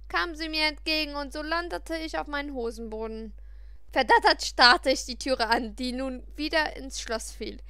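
ordinary delivery, nothing marked

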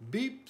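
short high toot; truck beep. A narrator's reading. Freed truck inviting friends aboard, high beep. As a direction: chirp a quick high beep. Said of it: "said Blue".

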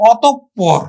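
অতঃপর as otop-por, held p; doubled consonant. Shown as a demonstration. Teacher telling students to look, doubled p in অতঃপর. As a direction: stop on the p in অতঃপর, then release it. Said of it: "দুইবার উচ্চারিত হবে".

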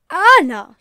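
loud, high cry of alarm; shocked exclamation. A narrator's reading. Missus Perkins sees her friend's face, exclaiming loudly. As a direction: call the name out in shock. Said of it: "she exclaimed".

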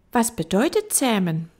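put-on high questioning child voice; little prince. Little prince asking the fox a question, high child voice. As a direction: ask like a curious small boy.

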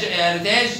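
ordinary talk

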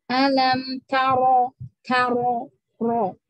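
"taro" with a thick, full ro, slow and loud; slow Quran recitation, "taro" heavy. Shown as a demonstration. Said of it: "dibacanya ro".

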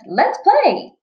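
high playful cat voice; Cheshire Cat voice. Acted. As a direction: tease like a mischievous cat. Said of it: "The Cheshire Cat jumps on her head and says".

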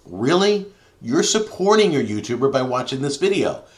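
high, loud disbelieving "really"; incredulous. Real, not acted. Vlogger scoffs at YouTube's new support button, incredulous.

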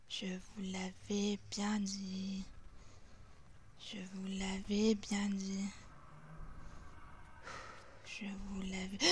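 a soft, creepy told-you-so whisper; eerie whisper. Pretend. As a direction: whisper the warning like a ghost. Spooky scene in a horror game. (murmure inquiétant)

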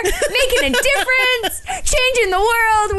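high, slow, starry-eyed gushing with a laugh; hammed-up dreamy do-gooder voice; sending up their own excitement, high and drawn out; mock idealistic gush.

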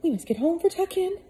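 high put-on voice of a hurried woman; flustered housekeeper. Missus Molasses rushing Peter home, high fussy voice. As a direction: hustle him along in a high fussy voice.

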